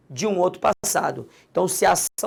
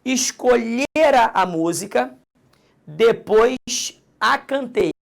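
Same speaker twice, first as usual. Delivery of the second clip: "Escolhera" drawn out slowly, with "a" stressed in "a cantei"; teacher modelling the sentence slowly for the class; emphatic example; 4 semitones higher, 4 dB louder, slower than usual. Shown as a demonstration. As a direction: stretch "Escolhera", then punch the little "a". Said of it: "Concentre comigo".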